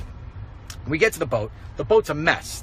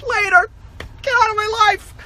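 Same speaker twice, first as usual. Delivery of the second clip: high, loud brush-off; mocking kiss-off; dismissive; 3 dB louder than usual. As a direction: wave them away. Dismissing critics.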